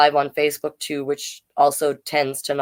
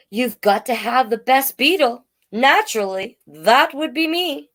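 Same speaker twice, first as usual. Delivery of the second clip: higher, puffed-up beetle voice; boastful beetle; 7 semitones higher than usual. Acted. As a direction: brag about being the best.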